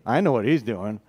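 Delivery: low-pitched, knowing mock grumble; lightly acted, low thinking-to-himself voice; suspicious inner thought. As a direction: voice a jealous brother's private suspicion. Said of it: "Probably thinking".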